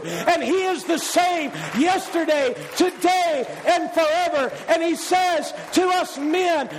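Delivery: rising, insistent sermon climax with shouts; preaching at full force. Acted. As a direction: drive it home to the men, building.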